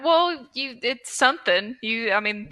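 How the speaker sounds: ordinary talk, nothing marked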